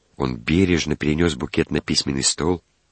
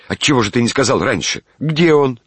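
high, louder reproach; agitated, raised-voice rebuke; exasperated scolding; 8 semitones higher, 4 dB louder than usual. A narrator's reading. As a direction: scold the servant, climbing to an urgent question. Master scolds servant over bouquet.

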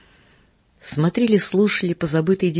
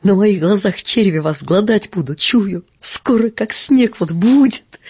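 frantic scream; 4 semitones higher, 5 dB louder than usual. A narrator's reading. Marina Semyonovna curses Uncle Andrey, frantic scream. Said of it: "закричала она истошным голосом".

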